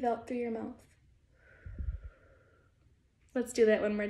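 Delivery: soft, unhurried meditation voice; guided breathing. Real, not acted. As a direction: count down and guide the breath gently. Leads a breathing exercise.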